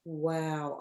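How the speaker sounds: usual speaking voice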